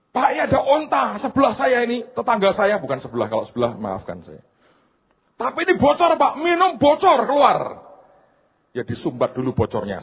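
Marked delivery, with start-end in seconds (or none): 0.1-2.8 high-pitched voice of a protesting congregant; hammed-up high objection; mock complaint. 5.4-7.6 very high, hammed-up protesting voice; exaggerated high wail of objection; mock alarmed complaint. Acts out congregant's alarmed complaint.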